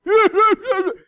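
loud, high-pitched bawling sobs; mock angry crying. Preacher imitating schoolboy after a fight, mock crying. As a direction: imitate a kid bawling in rage. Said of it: "throw a trash can and cry".

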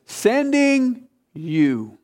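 Sending you stretched very slowly, Sending stressed; drawn-out emphasis. Shown as a demonstration. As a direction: draw out Sending you for the class to finish. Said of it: "what class?".

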